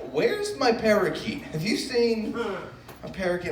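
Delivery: anxious high-pitched voice searching for a lost pet; worried neighbor. Retold or imitated. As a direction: neighbor hunting for her missing parakeet, high and fretful. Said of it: "my neighbor was like".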